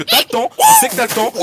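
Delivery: excited. Real, not acted. Excited caster call.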